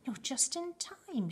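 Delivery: high, drawn-out urgent call; excited shout. A narrator's reading. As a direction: exclaim it slowly and eagerly. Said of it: "shouted Grandpa Joe".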